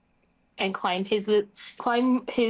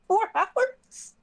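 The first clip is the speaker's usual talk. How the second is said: high incredulous echo; can't believe it, four hours; 12 semitones higher than usual. Retold or imitated. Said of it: "I was like".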